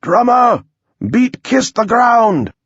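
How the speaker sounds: high, drawn-out commander's call; shouted order. Acted. Commander calling to the drummer, shouted order. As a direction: bellow the order slow and high.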